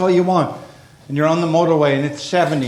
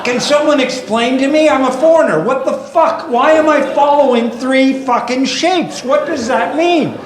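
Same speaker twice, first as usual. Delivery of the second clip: high, loud, indignant ranting; exasperated rant; 9 semitones higher, 3 dB louder than usual. Acted. Comic ranting at signs he must follow.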